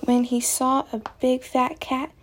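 ordinary delivery

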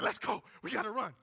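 loud, urgent rallying cry; urgent call. Retold or imitated. Elijah sees the rain cloud coming, urgent shout. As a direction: call out to get moving now. Elijah urges a run in story.

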